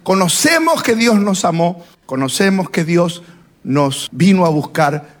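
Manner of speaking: ordinary talk, nothing marked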